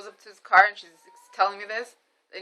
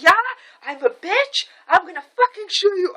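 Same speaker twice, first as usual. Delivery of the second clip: high mock rant, growing higher; furious outburst; 10 semitones higher, 4 dB louder than usual. Mom confronts driver in supermarket, high mock fury. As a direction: imitate mom losing it, raised pitch.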